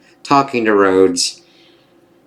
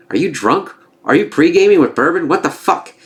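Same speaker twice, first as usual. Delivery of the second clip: high, rising mock disbelief; incredulous questioning; 8 semitones higher than usual. President reacting to Rhodes's odd advice, incredulous. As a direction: imitate someone baffled, pitch jumping up on each question.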